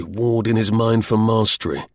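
ordinary delivery, nothing marked

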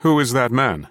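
narrated character's curious query. A narrator's reading. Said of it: "Barnes looked at him curiously".

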